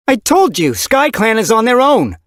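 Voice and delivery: high, loud, fierce; angry leader shouting her daughter down; furious retort. Acted. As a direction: bite back with rage. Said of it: "eyes blazed with fury".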